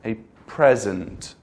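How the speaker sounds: "a present" slowly, as the noun PREZ-ent; first-syllable stress. Shown as a demonstration. Teacher saying it two ways, slow front-stressed "a present". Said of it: "say it two ways".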